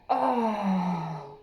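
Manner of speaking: slow, crying through the words; weeping. Retold or imitated. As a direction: let the tears break the line, slowly. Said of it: "weeping like".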